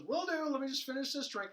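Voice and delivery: carefree crook stalling over his drink; breezy trickster. Retold or imitated. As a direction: toss it off like a barfly with no worries. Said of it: "He goes".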